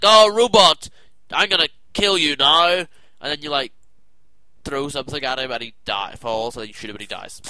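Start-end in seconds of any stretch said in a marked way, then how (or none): 0.0-2.7 loud taunt. Retold or imitated. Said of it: "And then you're just like".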